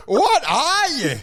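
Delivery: high, slow, drawn-out disbelief; mock bewilderment. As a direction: stretch it out high as an acted appalled bystander. Bystanders react to escaped robot.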